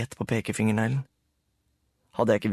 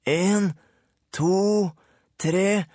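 Rulle counting; 9 semitones higher, 3 dB louder, much slower than usual. A narrator's reading. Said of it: "sa Rulle".

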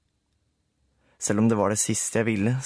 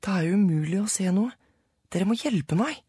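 Sara voiced; 9 semitones higher than usual. A narrator's reading. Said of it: "sa Sara".

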